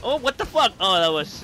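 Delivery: raging gamer. Real, not acted. Hit hard by the boss.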